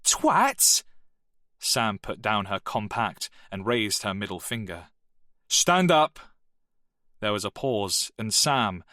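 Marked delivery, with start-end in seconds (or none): 0.0-0.8 a very high, loud insult spat out; furious girl. Acted. 5.5-6.2 a loud, higher barked order; angry command. Acted.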